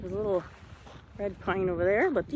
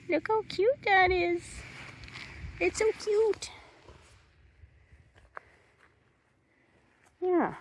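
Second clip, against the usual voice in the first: cooing; 13 semitones higher than usual. Real, not acted. Cooing over a tiny seedling.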